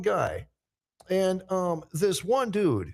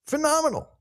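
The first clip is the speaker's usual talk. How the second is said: high playful echo; 10 semitones higher, 3 dB louder than usual. Acted. Echoes own word playfully.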